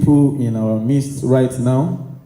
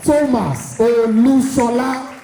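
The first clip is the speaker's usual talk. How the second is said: higher, slower grand MC build-up; announcer introduction; 6 semitones higher than usual. Acted. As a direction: announce the guest like a big reveal. MC introducing guest of honor.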